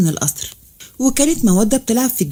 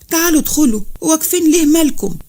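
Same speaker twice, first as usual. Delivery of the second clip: higher-pitched, glad welcome; lightly joyful; 6 semitones higher than usual. A narrator's reading. Mother welcoming her daughter at the door, lightly joyful. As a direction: usher them in with mild delight. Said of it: "بفرحة وبتقول".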